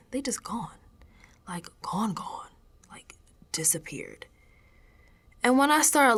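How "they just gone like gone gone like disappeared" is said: unvoiced whisper, softer and softer; hushed whisper. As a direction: whisper it like a secret you fear. Eerie discovery, mugs vanished.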